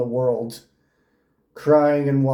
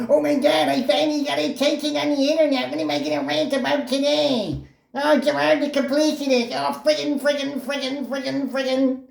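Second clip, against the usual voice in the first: much higher, fast mock whine; mocking high whiny voice; 12 semitones higher than usual. Mocking the YouTuber waking up to rant, high whiny voice. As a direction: parody a squeaky man-child ranting. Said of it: "He's got the most cringe voice you'd ever heard".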